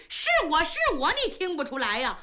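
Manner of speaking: ordinary delivery, nothing marked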